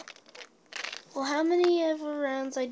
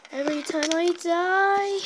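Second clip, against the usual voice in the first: louder, higher, stressed rule; emphatic; 5 semitones higher, 6 dB louder than usual. Real, not acted. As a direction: lay down the rule firmly.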